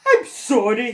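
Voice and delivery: loud flustered apology; spluttering. Acted. Dragon backing off from the beast, flustered splutter. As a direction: splutter it out in fright. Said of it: "he spluttered".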